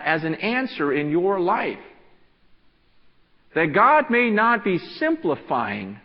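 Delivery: loud, high, urgent preaching; full-force preaching. Acted. Pastor urging the church to pray, preaching loud and high. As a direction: preach it hard to the congregation.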